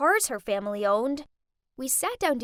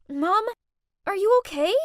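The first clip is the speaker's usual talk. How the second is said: louder, higher concern; worried; 6 semitones higher, 6 dB louder than usual. Acted. Daughter helping her pushed mother up, worried. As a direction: rush to her side.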